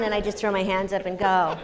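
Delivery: ordinary talk, nothing marked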